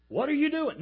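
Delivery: hollering. Acted. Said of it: "They would sit there hollering".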